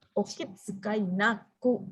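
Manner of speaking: slow, syllable by syllable: kitsukai naku; slow drill. Shown as a demonstration. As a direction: spell it out slowly for students. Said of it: "teacher drills the phrase slowly". (pelan-pelan, suku kata demi suku kata)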